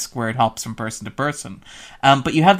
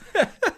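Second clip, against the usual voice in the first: laugh. Real, not acted. Laughing at the joke.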